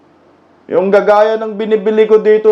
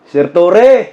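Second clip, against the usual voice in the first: teasing call; 6 semitones lower, 3 dB louder than usual. Real, not acted.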